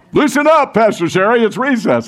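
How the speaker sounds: high, playful mock scolding; a light hammed-up schoolteacher voice; teasing teacher. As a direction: tease her like a fond teacher.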